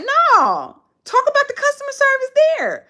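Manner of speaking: high, mocking demand to talk about the service; sarcastic high-pitched push. Mocking people who skip chain reviews, squeaky high. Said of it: "they'll talk about other stuff".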